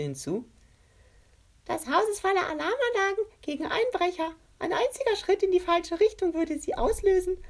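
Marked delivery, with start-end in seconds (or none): 1.6-7.4 very high voice. A narrator's reading. Henchman Kriecher warns about alarms.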